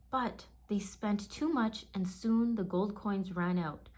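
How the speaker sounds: ordinary delivery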